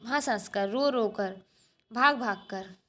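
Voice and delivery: normal talking voice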